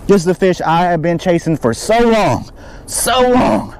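exult, leaning hard on "so long"; excited. Real, not acted. Angler holding the fish he chased so long, excited. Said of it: "I'm beyond excited".

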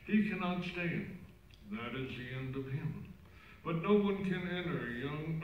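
cathedral echo. Real, not acted. Gospel read far off.

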